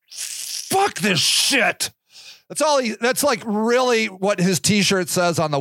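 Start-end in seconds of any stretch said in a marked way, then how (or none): 0.1-1.9 very slow, drawn-out weary sigh of a line; slow jaded villain mockery; fed-up Darth Vader. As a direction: drag it out like a tired dark lord. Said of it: "He's just like".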